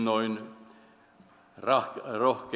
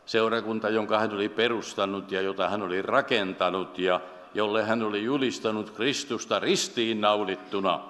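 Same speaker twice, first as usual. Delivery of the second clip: normal talking voice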